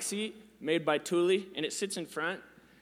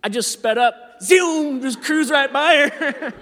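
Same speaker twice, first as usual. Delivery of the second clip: much louder, higher, laughing as he zooms past; excited laughing rush; 8 semitones higher, 9 dB louder, slower than usual. Real, not acted. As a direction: race through it, laughing. Excited retelling of escape.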